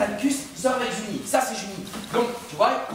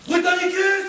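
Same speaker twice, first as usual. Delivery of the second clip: calling out; 7 semitones higher, 4 dB louder, slower than usual. Retold or imitated. Calling out at the door.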